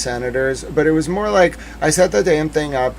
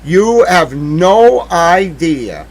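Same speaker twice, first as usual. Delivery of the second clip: slow furious emphasis; 2 semitones higher, 6 dB louder, much slower than usual. Real, not acted. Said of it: "I was furious".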